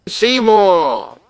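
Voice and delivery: loud booming call; booming shout. Acted. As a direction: shout his name, booming. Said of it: "with a booming voice, shouts".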